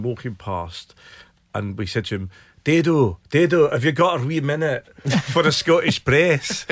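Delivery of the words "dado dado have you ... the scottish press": raised, louder mock Scottish accent; hammed-up Scottish accent to hail someone; put-on Scottish accent. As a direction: call out in a put-on Scottish brogue. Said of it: "conducted the whole interview in Scottish accent".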